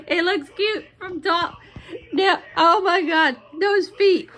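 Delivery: words squeezed out between laughs; laughing. Real, not acted. Vlogger giggling at the gingerbread man's feet, laughing. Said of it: "I'm still dying laughing".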